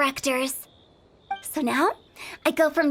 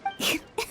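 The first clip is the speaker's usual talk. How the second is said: playful laugh; 7 dB quieter than usual. Acted. Hu Tao amused at protest.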